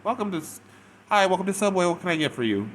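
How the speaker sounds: high, loud customer-service chirp; over-bright fake retail greeting; fake happy. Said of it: "when you're fake happy".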